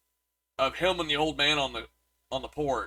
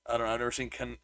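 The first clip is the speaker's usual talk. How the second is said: usual speaking voice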